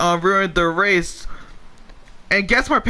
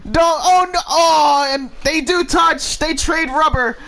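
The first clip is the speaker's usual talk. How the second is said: excited commentary; 6 semitones higher than usual. Real, not acted. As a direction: call the action at full excitement. Three-wide crash, excited commentary.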